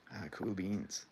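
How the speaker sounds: ordinary delivery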